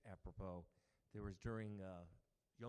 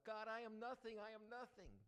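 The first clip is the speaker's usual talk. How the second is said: much higher, loud pleading wail; crying out; 14 semitones higher, 4 dB louder than usual. Retold or imitated. Second rabbi crying out at the altar, high wail. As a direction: outdo the first rabbi with a higher cry. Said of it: "he too cries out".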